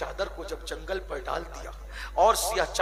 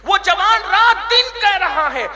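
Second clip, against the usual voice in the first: much higher, much louder, slow cry; preaching at full force; 12 semitones higher, 12 dB louder, slower than usual. Real, not acted. Preacher cries out the young man's story to the crowd. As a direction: cry it out at the top of the voice.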